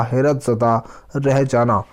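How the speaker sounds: normal talking voice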